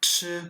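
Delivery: che held high and level; che, high level first tone. Shown as a demonstration. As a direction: sustain che on one high flat pitch. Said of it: "un ton haut et plat, avec un son continu".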